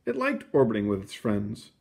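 ordinary delivery, nothing marked

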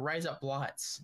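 Australian "razor blights"; Australian-accented "razor blights", rising to a question; thick Australian accent. Shown as a demonstration. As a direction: turn "blades" into "blights", Australian-style. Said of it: "Australian with a very thick accent".

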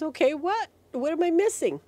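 high-pitched, puzzled questioning; eager kid. Acted. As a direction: play a kid impatient to know. Acts the kid's eager question.

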